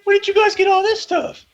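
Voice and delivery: high, awed gushing over new tech; wide-eyed tech geek amazement; gushing Tony Stark. Retold or imitated. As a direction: squeal it like a kid in a toy store. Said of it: "he's gonna go to Wakanda and be like".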